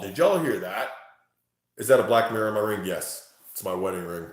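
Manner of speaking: usual speaking voice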